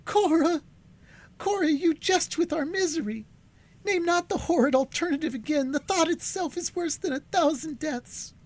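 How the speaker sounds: high, outraged pleading voice; indignant youth. A narrator's reading. Duncan rejecting the horrid alternative, high and indignant. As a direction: refuse it, hurt and outraged.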